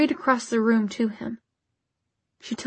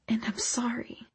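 whispered; 8 dB quieter than usual. A narrator's reading. Hushed apology ending confession.

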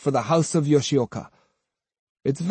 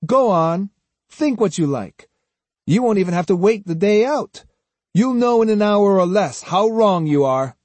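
higher, snide superiority; 8 semitones higher, 3 dB louder than usual. A narrator's reading. Swordsman scorning men on the way down, snide. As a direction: needle them with mock regret. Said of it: "Kojiro's tone became snide".

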